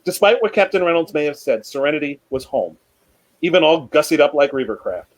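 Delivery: measured, unhurried reading of a tense chapter; story narration. A narrator's reading. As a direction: read it aloud like a story to an audience. Reads fan fiction aloud.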